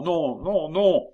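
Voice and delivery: put-on high, rising child's protest; child's refusal. A narrator's reading. Royal child refusing to sing, high insistent voice. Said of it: "dit l'enfant".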